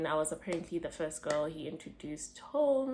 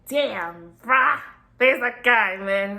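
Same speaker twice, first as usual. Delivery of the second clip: excited; 3 semitones higher, 11 dB louder than usual. Retold or imitated. Excited quote on seeing Mike.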